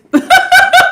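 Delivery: sudden high burst of laughter; bright real laugh at the reading. Real, not acted. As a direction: let a surprised laugh burst out. Reacting to a surprising card.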